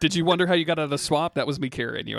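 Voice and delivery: normal talking voice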